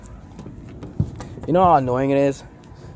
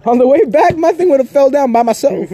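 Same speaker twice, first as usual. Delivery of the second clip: high, loud complaint, comically indignant; outraged protest; 11 semitones higher, 6 dB louder than usual. Retold or imitated. Driver left alone with a falling bumper, loud comic outrage. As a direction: push it up high and loud as an aggrieved rant.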